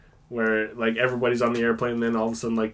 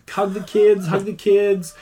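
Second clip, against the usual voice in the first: high, louder pleading on the phone; frantic passenger plea; 8 semitones higher, 5 dB louder, slower than usual. Retold or imitated. Passenger on the phone to family, high frantic plea. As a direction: beg a loved one to hug the kids.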